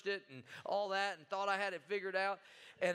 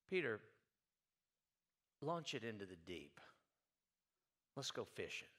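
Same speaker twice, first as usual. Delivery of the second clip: gentle call; 11 semitones lower, 8 dB quieter than usual. Retold or imitated. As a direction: lower and softer, calm invitation. Jesus voiced calling Peter.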